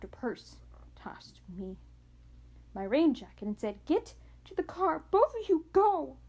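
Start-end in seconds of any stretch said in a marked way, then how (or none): 3.8-6.2 high, loud order to run; frantic parent barking orders; urgent command. Acted. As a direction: hurry them out with a shout. Mother urgently orders them to flee.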